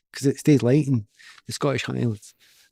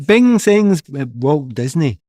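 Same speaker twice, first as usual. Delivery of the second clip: Glasgow accent; 2 semitones higher, 6 dB louder, slower than usual. Shown as a demonstration. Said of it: "with a Glasgow accent".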